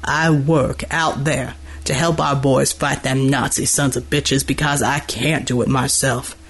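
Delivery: understated anger in a deep big-man voice; low snarl. A narrator's reading. Dockworker towering over seated military man, restrained low snarl. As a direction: growl it low, anger held down. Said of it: "Gordy's last words came in a snarl".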